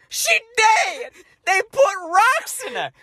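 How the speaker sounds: high, loud crying wail; hammed-up sobbing shout; mock yell. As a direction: wail it like a distraught kid in tears. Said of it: "one of the kids named Sean is yelling".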